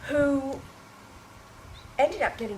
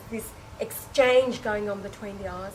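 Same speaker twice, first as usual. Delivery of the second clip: ordinary talk, nothing marked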